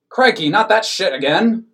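loud, high exasperated cry; cried out. A narrator's reading. Skimpy groaning at the nun bread, loud cry. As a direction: blurt it out in disgust. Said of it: "cried Mister Skimpy".

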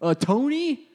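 mock surprise. Women reacting to the medium.

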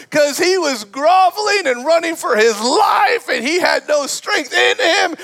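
high-pitched, impassioned preaching shout; full-force preaching. Real, not acted. Preacher tells congregation of Elijah's need for rescue, impassioned preaching.

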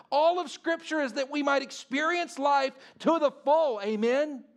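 high, loud, driving sermon delivery; preaching at full force. Acted. Pastor urging the congregation to set boundaries, preaching hard. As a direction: preach it out with full conviction to the church.

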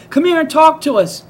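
high loud beckoning call; hollered invitation. Retold or imitated. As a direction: insist he come over. Girls calling him over.

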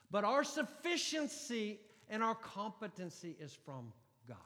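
loud, high, impassioned preaching; fiery sermon delivery; preaching at full force. Acted. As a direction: proclaim it to the back row. Preaching scripture loudly.

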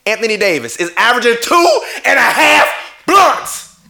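reads the stat through a laugh; chuckling, can't believe the number; laughing disbelief. Real, not acted. Laughing at the stat while ranting to viewers about the MVP.

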